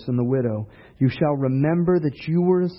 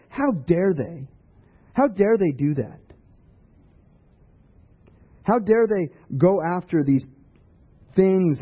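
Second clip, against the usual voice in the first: higher-pitched mock indignation; scoffing; 4 semitones higher than usual. Preacher imitating Christians judging the world, mock-indignant scoff. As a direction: put on a scoffing, offended tone. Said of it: "scoff at them and be like".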